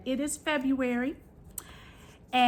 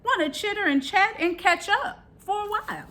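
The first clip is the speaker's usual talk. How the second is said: high chirpy crow voice; chatty crow; 10 semitones higher than usual. Acted. Crow character, higher.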